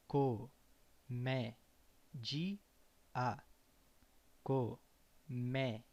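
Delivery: Comédia slowed, with mé stressed and open. Shown as a demonstration. Teacher models stressed syllable slowly for learners, mé stressed. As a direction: stretch Comédia, leaning on mé. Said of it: "With acento agudo".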